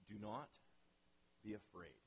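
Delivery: low, soft, measured command; quiet reassurance. Acted. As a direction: say it low and hushed, with pauses. Moses calmly answers the terrified people.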